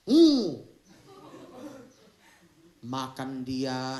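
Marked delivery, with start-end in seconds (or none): 0.1-0.6 disgusted groan. Acted. Recoiling at the worms.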